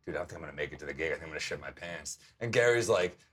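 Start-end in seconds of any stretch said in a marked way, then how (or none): 0.1-2.2 fast, soft, rushed confession; panicked mutter. Retold or imitated. Comic in an Uber telling his friend he's panicking, fast and soft. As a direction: hurry it out under your breath. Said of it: "I'm in the car like".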